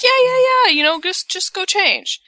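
high, hurried-off brush-off; mock dismissive. Wife sending husband to change, mock dismissive. Retold brush-off before the shoot.

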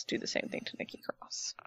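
whisper. Pretend. Unvoiced, much softer.

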